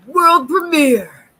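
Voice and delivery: loud, slow grand announcer; big showbiz announcement. Pretend. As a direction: announce it like a premiere. Announcer flourish.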